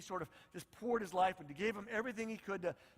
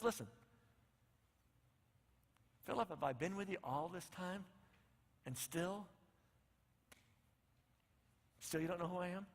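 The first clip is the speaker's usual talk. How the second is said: hurt and soft; 3 semitones lower, 7 dB quieter than usual. Retold or imitated. Jesus hurt, softly.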